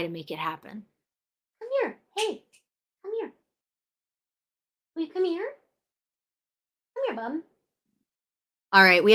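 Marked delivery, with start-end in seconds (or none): 1.6-7.4 high, soft sing-song pet call; high pet-talk calling Rufus to come over. Real, not acted. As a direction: coax the dog over sweetly. Coaxing pet to camera.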